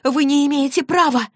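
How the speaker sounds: high-pitched indignant cry; shouting. Acted. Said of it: "закричала Клэри". (возмущённый крик высоким голосом)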